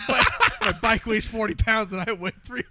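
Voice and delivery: high, loud laughing shout. Retold or imitated. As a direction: laugh through the excuse. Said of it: "I'm like".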